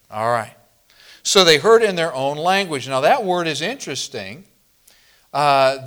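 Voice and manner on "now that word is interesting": ordinary delivery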